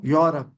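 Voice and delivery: "Europe" said YAW-rup; correct pronunciation. Shown as a demonstration. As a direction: start "Europe" with yo, not you. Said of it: "So the pronunciation is Europe".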